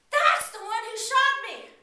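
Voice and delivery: high, loud accusing cry; pained cop calling out; wounded cop accusing. Acted. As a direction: point him out loudly. Wounded cop identifies shooter.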